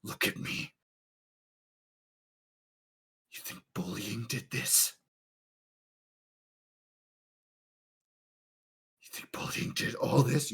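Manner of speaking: a cold, soft, threatening whisper; menacing whisper. Acted. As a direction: breathe the threat right into their face. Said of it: "and goes".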